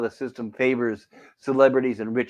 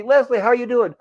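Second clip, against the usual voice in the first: high, bright-pitched chirpy coworker chatter; jovial office greetings; 11 semitones higher, 3 dB louder than usual. Retold or imitated. Coworkers cheerfully greeting each other in the office, high and chirpy. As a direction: play every coworker as cheery and upbeat. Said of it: "they're all happy and jovial".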